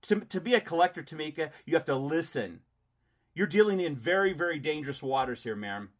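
loud threatening scolding; angry. Real, not acted. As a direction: warn her angrily. Heated argument with collector.

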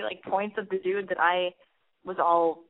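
ordinary talk, nothing marked

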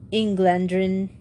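ordinary delivery, nothing marked

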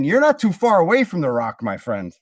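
ordinary talk

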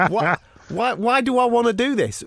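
high-pitched comic exasperation; exasperated. Retold or imitated. Exasperated rant about the demonstration.

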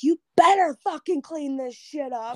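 high, forceful retold warning; angry threat. Retelling how she stormed towards the cousin, angry threat. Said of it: "I start storming towards her".

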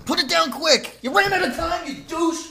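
high, loud outburst at a teammate; worked-up game-night shouting; heated yelling. Real, not acted. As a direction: yell it in a frantic rush of protest. Ribbing partner as timer runs out.

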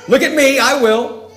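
high, loud boast; mock self-important announcement; self-elevating. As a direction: show off, stressing "I" in "I will". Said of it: "it is elevating himself".

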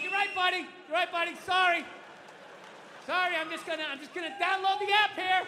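high, tense mock apology; strained apology. Dad answering his scolding daughter, strained apology. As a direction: apologize while still worked up.

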